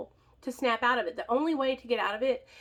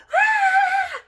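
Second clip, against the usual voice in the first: loud, high mock shriek; sister's startled shriek, sustained and high; imitated scream; 13 dB louder than usual. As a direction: let out a high piercing scream. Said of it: "and she screams".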